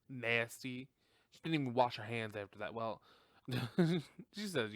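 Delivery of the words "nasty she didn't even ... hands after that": ordinary delivery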